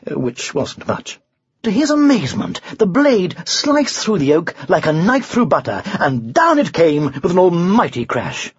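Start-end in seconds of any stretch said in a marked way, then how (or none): none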